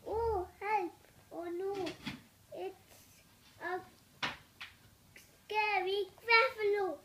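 loud hammed-up fright; mock scared cry. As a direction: shout it like a scared mouse. Child acting the scared mouse.

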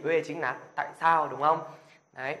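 ordinary talk, nothing marked

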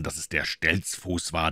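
ordinary delivery

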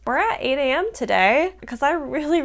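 ordinary talk, nothing marked

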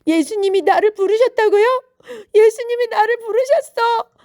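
high, loud, astonished delight; overjoyed. A narrator's reading. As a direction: burst out as if jumping for joy. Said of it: "제자리에서 뛰며 기뻐했어요".

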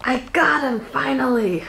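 triumphant. Real, not acted. Triumph at catching it.

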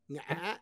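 NGÃ with a break mid-vowel; soft ngã, voice breaking and jumping up; broken squiggly tone. Shown as a demonstration. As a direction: break the sound inside "ngã", northern style. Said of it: "they break when they're saying it".

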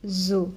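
"zo" with a buzzing z; voiced z. Shown as a demonstration. Teacher showing the sounds differ, buzzed "zo". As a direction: buzz into "zo", keeping it low-key. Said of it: "Vous entendez, ce n'est pas pareil".

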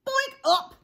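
high, loud "boink" and startled squawk; sudden cartoon bonk, then a sharp yelp. Acorn hits the chick on the head, startled squawk. Collision with Turkey Lurkey.

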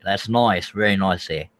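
Aussie accent, "nice" said like "noice"; Australian accent. Shown as a demonstration. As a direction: stretch "nice" into an Australian oi sound. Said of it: "more like an oi".

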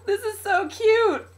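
high, squealing laugh into words; overwhelmed, high-pitched giggly gushing; delighted tearful laughing. Real, not acted. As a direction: laugh until nearly crying. Delighted by card and stickers.

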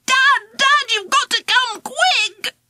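high, urgent scream for Dad. Acted. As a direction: shriek it into Dad's ear. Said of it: "screamed in his ear".

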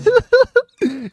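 a high, amused giggle; laughing at his friend's outburst. Real, not acted. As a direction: crack up at him.

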